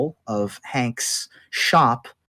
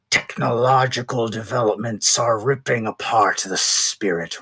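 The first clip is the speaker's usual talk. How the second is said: slow, lower voice of a solemn order; stern Order guardian; 4 semitones lower than usual. Acted. Order member warning the party about the spirit realm, stern. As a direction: lay down the Order's warning, measured and grave.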